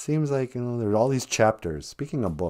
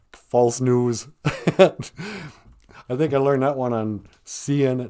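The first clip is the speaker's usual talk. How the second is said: ordinary talk, nothing marked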